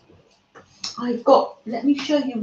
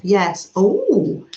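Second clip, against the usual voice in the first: usual speaking voice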